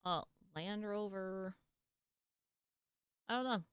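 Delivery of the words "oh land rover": normal talking voice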